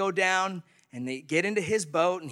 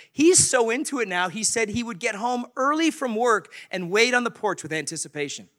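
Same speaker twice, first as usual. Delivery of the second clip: usual speaking voice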